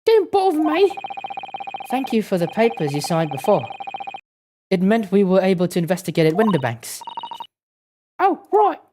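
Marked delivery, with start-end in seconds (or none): 0.1-0.9 put-on high Cockney girl, sulky; Cockney accent. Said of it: "doing the Cockney accent in her voice". 8.2-8.8 put-on high Cockney girl, sulky; Cockney accent. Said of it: "doing the Cockney accent in her voice".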